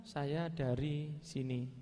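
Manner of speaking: slow, drawn-out answer; bicara pelan. Retold or imitated. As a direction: imitate a man speaking very slowly. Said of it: "ngomongnya pelan". (menirukan orang yang bicaranya pelan sekali)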